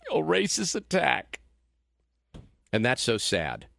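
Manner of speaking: usual speaking voice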